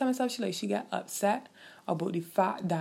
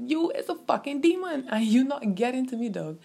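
retold defiance; 6 semitones higher, 4 dB louder than usual. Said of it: "I said".

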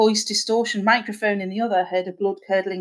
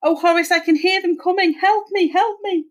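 high, frantic pleading; panicked cry for help; 9 semitones higher than usual. A narrator's reading. Spirit cries to husband as demons approach, panicked. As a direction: climb higher in rising panic.

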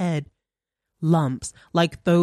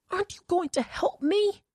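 much higher, thin wheedling plea; 4 dB quieter than usual. A narrator's reading. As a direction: coax for help. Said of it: "Her voice wheedled out".

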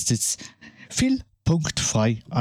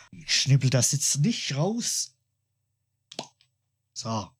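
while sipping a drink; 4 dB quieter than usual. Real, not acted. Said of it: "ich muss nachher mal einen Schluck trinken".

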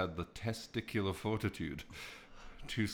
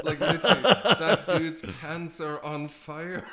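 laughing; 7 semitones higher, 8 dB louder than usual. Real, not acted. Laughing at the burning man.

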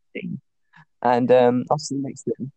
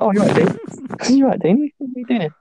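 surprised greeting; 9 semitones higher, faster than usual. Retold or imitated. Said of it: "I was like".

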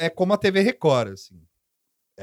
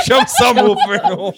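laughing, higher and louder; 7 semitones higher, 4 dB louder than usual. Real, not acted. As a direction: call out mid-laugh. Laughing joke.

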